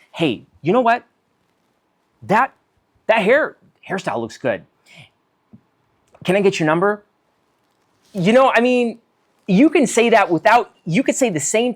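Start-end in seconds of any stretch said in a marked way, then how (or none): 0.1-7.0 higher, pushy, overeager compliment; needy eager compliment. Pretend. Stranger pushing for a number, needy eager compliment. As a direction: hurry the flattery like you need the number. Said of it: "something nice to you like this".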